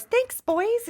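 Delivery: put-on very high, girly-pitched sign-off; movie heroine impersonation. Heroine leaving the courthouse thanks the men, high-pitched. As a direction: lift it way up like the film's heroine. Acting the heroine's exit in Legally Blonde.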